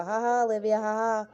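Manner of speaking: put-on childish victory cackle at a rival; mocking laugh. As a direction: taunt her with a mock laugh. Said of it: "dance and sing and laugh and go".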